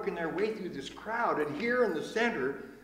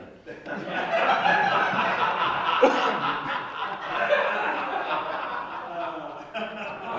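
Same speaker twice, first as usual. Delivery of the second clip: loud real laugh; big laugh; 3 semitones higher, 7 dB louder than usual. Real, not acted. Teller laughing at his own punchline, loud laugh. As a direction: burst out laughing.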